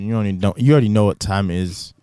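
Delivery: ordinary talk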